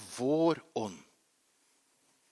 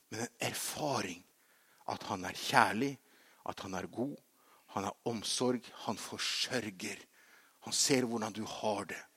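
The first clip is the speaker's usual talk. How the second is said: gentle preaching; 2 semitones lower, 4 dB quieter than usual. Real, not acted. Preacher drops to tender teaching.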